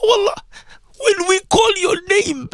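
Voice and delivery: ordinary talk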